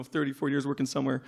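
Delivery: ordinary talk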